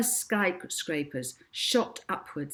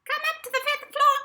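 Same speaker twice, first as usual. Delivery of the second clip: much higher, quick squeaky instruction; squeaky woman's voice, fast; 5 dB louder, faster than usual. A narrator's reading. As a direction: rattle it off in a tiny squeak. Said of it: "said a squeaky woman's voice down the entry phone".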